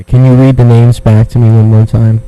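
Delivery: ordinary delivery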